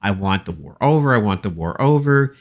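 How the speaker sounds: usual speaking voice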